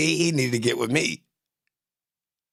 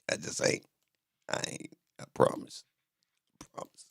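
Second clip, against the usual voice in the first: breathy unvoiced whisper, trailing off; 10 dB quieter than usual. Real, not acted. Streamer whispering a promise to himself. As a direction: drop to a whisper as if swearing to yourself.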